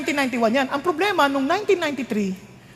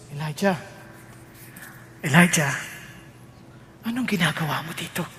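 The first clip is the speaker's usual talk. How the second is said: soft God voice; 8 semitones lower, 6 dB quieter than usual. Acted. God calling softly again.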